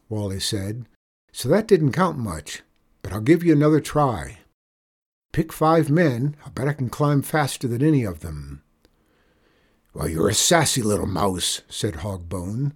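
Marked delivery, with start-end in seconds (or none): 1.3-8.6 put-on higher boy's voice, mildly cocky; plucky boy. Boy offering the outlaw another try, cocky. 9.9-11.6 gruff cowboy boss voice; scornful outlaw boss. Acted. Outlaw boss putting down the sassy boy, scornful.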